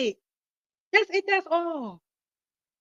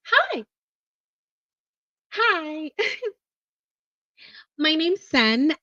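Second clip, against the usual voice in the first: bright sweet phone voice, laughing through it; 2 semitones higher, slower than usual. Shown as a demonstration.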